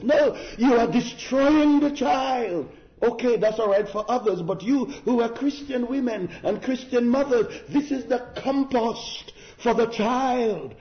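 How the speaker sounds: high, forceful sermon voice; impassioned preaching. Real, not acted. Preaches with raised voice.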